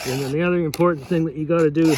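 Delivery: ordinary delivery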